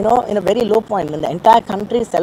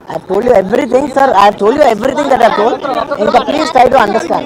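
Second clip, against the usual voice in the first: louder, urgent pleading to be understood; earnest loud plea; 3 semitones higher, 8 dB louder than usual. Real, not acted. To the reporters, begging them to understand, louder and urgent. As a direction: press it on them, begging them to believe you.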